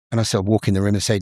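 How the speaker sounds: normal talking voice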